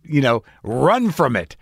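ordinary delivery

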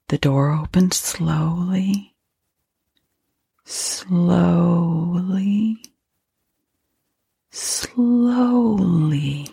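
very slow, each slowly stretched longer; drawn-out suspense. A narrator's reading. Narrator watches the door open slowly, drawn-out dread. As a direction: stretch the vowels, lifting the last slowly.

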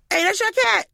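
high-pitched, called-out question; neighbor's voice. Retold or imitated. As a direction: imitate a neighbor calling over in a high voice. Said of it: "neighbor goes".

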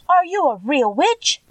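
high, slow wide-eyed question; a girl amazed, a bit frightened; awed question. A narrator's reading. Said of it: "cried Dorothy".

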